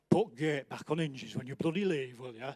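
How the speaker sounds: gruff Yorkshire grumble, trailing away; a blunt Yorkshireman telling you off; dropping low and soft into a Yorkshire mutter at the end; Yorkshire accent. Retold or imitated. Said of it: "He's from Yorkshire".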